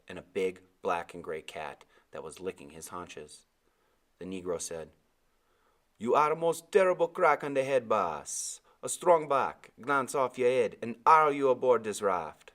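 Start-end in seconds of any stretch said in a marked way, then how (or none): 6.0-12.5 higher, louder island sailor voice; old West Indian sailor. A narrator's reading. Sailor explaining the boy's head injury. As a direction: speak as the big old deckhand.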